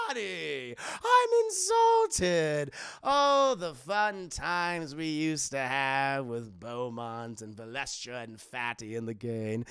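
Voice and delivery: shrieking mock offence into slow reminiscing drawl; hammy villain, wildly swinging pitch; Joker.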